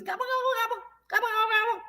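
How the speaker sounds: turkey gobble. Shown as a demonstration.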